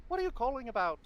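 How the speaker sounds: high, loud Irish mother; Irish accent. Acted. Mother answering her shouting boy, high Irish accent. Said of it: "she's Irish instead".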